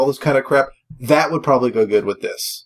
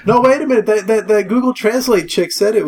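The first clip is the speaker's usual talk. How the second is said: surprised objection; 8 semitones higher, 3 dB louder than usual. Real, not acted.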